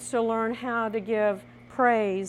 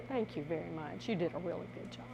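soft praise; 4 semitones lower, 10 dB quieter, faster than usual. Shown as a demonstration. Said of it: "learn to give praise".

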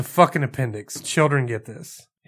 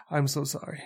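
sheepish apology; 8 dB quieter than usual. Retold or imitated. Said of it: "I'm like".

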